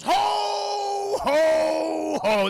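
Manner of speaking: loud, high ho ho ho; mall Santa laugh. Retold or imitated. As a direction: greet the kid with a big Santa boom. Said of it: "and he goes".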